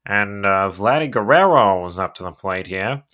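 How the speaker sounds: sportscaster impression with swooping emphasis; play-by-play announcer. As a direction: call the at-bat like a veteran broadcaster. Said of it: "just as excited to hear".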